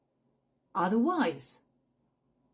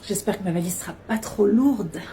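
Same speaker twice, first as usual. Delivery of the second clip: worried traveller; 7 dB louder than usual. Acted. Acted worry about suitcase.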